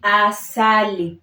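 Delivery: asali said slowly, a-sa-li, open a; Swahili asali. Shown as a demonstration. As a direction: model asali for repeating. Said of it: "we say asali".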